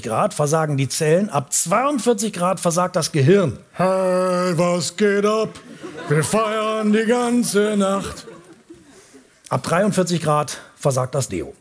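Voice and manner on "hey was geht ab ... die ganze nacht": partying voice, slow and drawn out. Acted. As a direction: whoop like a drunk party guest. Brain cells partying, far voice.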